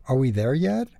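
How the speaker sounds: usual speaking voice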